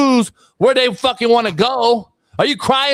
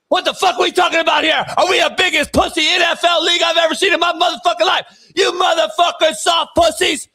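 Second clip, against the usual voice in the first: furious rant; 6 semitones higher than usual. Acted. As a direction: yell it out like a fired coach losing it. Same ranting coach character continues.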